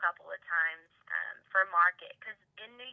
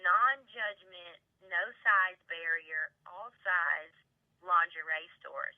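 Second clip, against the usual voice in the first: slow emphasis; much slower than usual. Real, not acted.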